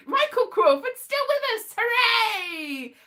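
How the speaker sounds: high, loud overjoyed proclamation; mock joyful cry. Proclaiming to friend that Crawford lives, mock joyous. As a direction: announce it like wonderful news. Mock celebration of living actor.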